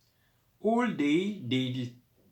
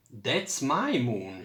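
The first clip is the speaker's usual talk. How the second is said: ordinary delivery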